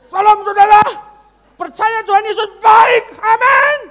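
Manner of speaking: high, very loud bellow; hammed-up full-volume pulpit shouting; screaming preacher. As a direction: imitate a preacher yelling every phrase. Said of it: "Ngapain teriak-teriak?".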